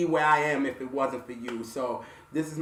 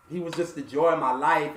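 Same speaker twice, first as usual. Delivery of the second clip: normal talking voice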